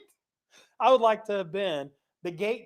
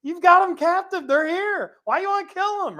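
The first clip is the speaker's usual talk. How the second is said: Elisha's retort; 9 semitones higher than usual. Retold or imitated. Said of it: "He's like".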